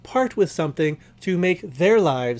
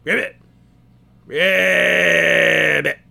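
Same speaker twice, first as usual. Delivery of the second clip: frog croak; 5 dB louder, slower than usual. Acted. Thespian vampire frog croaks.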